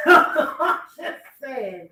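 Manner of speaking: slow, laughing through the words; drawn out with a laugh. Real, not acted. Host laughing at Steve's advice, slow.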